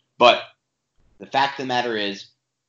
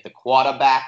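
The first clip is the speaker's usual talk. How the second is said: imitated Boston accent, dropped R; 3 semitones higher, 7 dB louder than usual. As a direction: say quarterback like a Bostonian. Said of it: "say it in a Boston accent".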